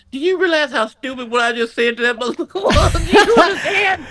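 high-pitched disbelief breaking into laughter; freaking out. Retold or imitated. Robbery victim marveling at his own backtalk, freaking out.

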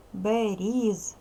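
Берізки slowly, syllable by syllable, with a buzzing Z; careful teacher's Берізки with Z, not S; clear voiced consonant. Shown as a demonstration. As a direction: draw out Берізки, keeping З voiced before К. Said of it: "Вимовляй чітко".

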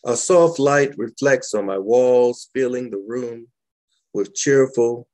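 calm, measured poetry recital; gentle spoken-word reading; poem reading. Acted. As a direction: savor each image as you read. Reads second poem aloud.

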